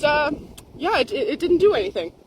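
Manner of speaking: ordinary talk, nothing marked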